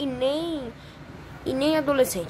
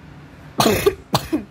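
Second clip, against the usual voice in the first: harsh coughing fit; choking cough; 4 dB louder than usual. Real, not acted. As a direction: cough as if something went down wrong.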